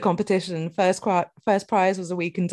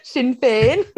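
raised, louder go at Northern Irish; failed Northern Irish accent; 5 semitones higher, 4 dB louder, slower than usual. Retold or imitated. Imitating Danny's failed attempt, weak Northern Irish accent. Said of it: "said Sinn Féin in her own accent".

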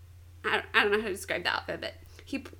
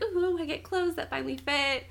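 higher-pitched, thrilled little squeal of delight; excited; 6 semitones higher than usual. Retold or imitated. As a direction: imitate a delighted girl getting new clothes. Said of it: "she gets really excited".

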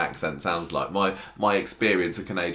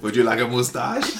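mock French Canadian accent; 4 semitones higher, 4 dB louder than usual. Co-host teasing about a French Canadian friend, mock French Canadian accent. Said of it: "a friend who was French Canadian".

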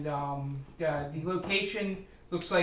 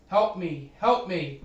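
understated cry for help, a little louder; mild acted yell; 3 semitones higher, 6 dB louder, slower than usual. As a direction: call out for help without fully screaming. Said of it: "a voice screaming out, yelling".

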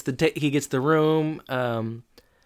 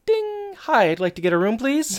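much higher, sing-song bell ding and chirpy request; Corey at the desk; 10 semitones higher than usual. Retold or imitated. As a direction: chirp a ding, then ask sweetly for a room. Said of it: "and Corey goes".

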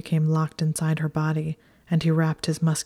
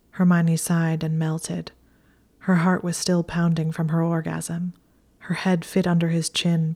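plain reading voice

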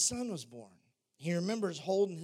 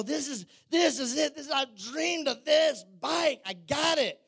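higher, louder thrilled exclaim; excited bike dream; 9 semitones higher, 6 dB louder than usual. Retold or imitated. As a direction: gush over the dream bike. Retold thrill over new bike.

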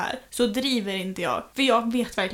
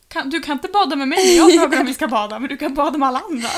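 higher, louder, rushed mock grievance; mock sulky complaint; 4 semitones higher, 9 dB louder, faster than usual. [name] imitating herself complaining to Nina, mock offended. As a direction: play up being offended. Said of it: "jag var typ så här".

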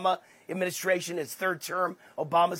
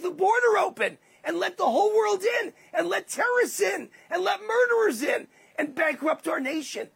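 outraged shout; 9 semitones higher than usual. Real, not acted. Outraged rhetorical rant.

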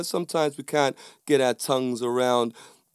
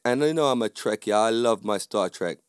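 ordinary delivery

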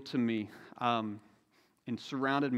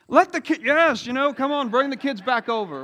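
high, loud hasty about-face; flustered backpedaling; 10 semitones higher, 8 dB louder than usual. Retold or imitated. Disciples hastily calling the kids back after Jesus speaks, high flustered. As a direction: scramble to undo your mistake.

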